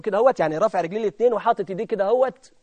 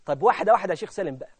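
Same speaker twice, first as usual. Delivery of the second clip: ordinary delivery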